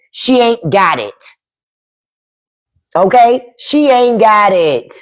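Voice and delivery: ordinary talk, nothing marked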